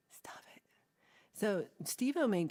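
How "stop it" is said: whispered. Acted. Playful scolding of chat.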